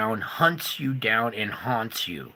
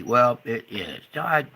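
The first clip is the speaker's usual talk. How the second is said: ordinary talk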